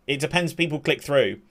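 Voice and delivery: ordinary delivery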